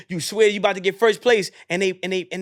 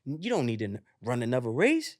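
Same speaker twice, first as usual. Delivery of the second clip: lower, softer tempter; tempting other voice; 5 semitones lower, 6 dB quieter than usual. Retold or imitated. Another voice tempts the runner, low and coaxing. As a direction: coax them quietly out of it. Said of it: "you hear another voice saying".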